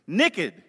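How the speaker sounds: "Naked" said Southern-style as "nekkid"; Southern pronunciation. Shown as a demonstration. Pastor showing how the South says it, "Naked" as "nekkid". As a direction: shorten the first vowel of "Naked" to "nick-id". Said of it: "That's what we say in the South".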